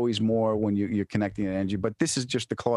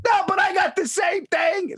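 high-pitched panicked protest; mock hysterical shout; 4 dB louder than usual. Friend arguing about the same shot, mock hysterical shout. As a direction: imitate a friend refusing to be calmed.

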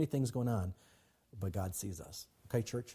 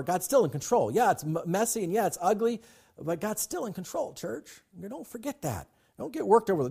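preaching at full force; 9 semitones higher than usual. Real, not acted. Pastor rallying his church, fervent high preaching. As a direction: lift the congregation with a fervent peak.

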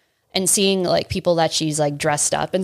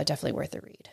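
whisper; 2 semitones lower, 12 dB quieter, much faster than usual. Real, not acted. Trailing whisper.

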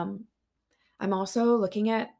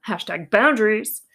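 playful punchline; 9 dB louder than usual. Real, not acted. Joking punchline.

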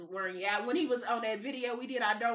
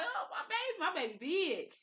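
doting coo; 8 semitones higher, 6 dB quieter than usual. Retold or imitated. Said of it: "I'm looking up to him like".

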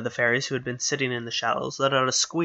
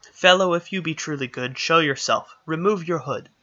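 raised, higher call across the glade; 4 semitones higher, 5 dB louder than usual. Acted. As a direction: call him out loud and commanding. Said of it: "called out".

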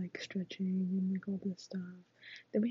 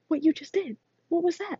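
high, loud, demanding bark; coach yelling; 8 semitones higher, 7 dB louder than usual. Retold or imitated. Coach yelling at his player after her big hit, loud and high. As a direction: hammer the question at her.

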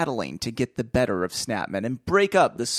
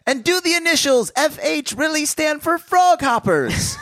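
high, loud radio narrator; 1950s narrator; 12 semitones higher, 4 dB louder than usual. Acted. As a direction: shout it like an old serial announcer. Mock serial cliffhanger question.

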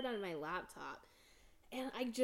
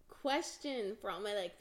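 ordinary delivery

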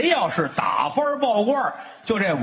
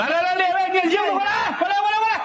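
high, carrying call to the monks; calling everyone over to the hall; 12 semitones higher than usual. Pretend. Summoning monks to the mourning hall.

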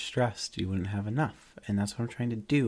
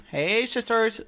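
James Charles greeting; 12 semitones higher, slower than usual. Retold or imitated. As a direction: belt out the YouTuber catchphrase. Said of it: "James Charles".

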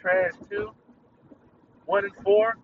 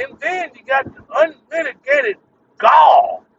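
gall flung out in a loud shout; indignant stress on gall; 10 semitones higher, 12 dB louder, slower than usual. Real, not acted. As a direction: build up to an outraged shout on gall. Ranting about coworker's smell.

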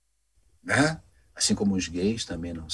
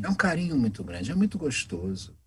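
usual speaking voice

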